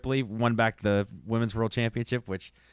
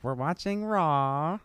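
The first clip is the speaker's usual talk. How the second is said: slow, higher fake announcer voice; mock commentary; 6 semitones higher, much slower than usual. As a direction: stretch it out like a wrestling commentator. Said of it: "He's like".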